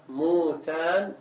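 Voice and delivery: "موتان" said slowly as muu-tan; u-vowel form. Shown as a demonstration. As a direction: draw out "موتان" with a long u first, mutan. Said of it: "صيغته سماعية تكون إذن".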